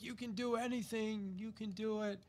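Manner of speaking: high, soft encouraging voice; supportive wife. Retold or imitated. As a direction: gently cheer him on, voice up high. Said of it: "my wife's always massively supportive".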